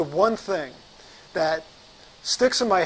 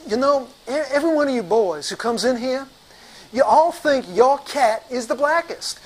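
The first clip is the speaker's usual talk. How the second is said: exasperated doctor in a raised voice; Doctor Roscoe; 4 semitones higher than usual. Retold or imitated. Doctor shaking his head at the rep, exasperated dismissal. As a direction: scold the boy, then dismiss him curtly. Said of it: "He's shaking his head and he says".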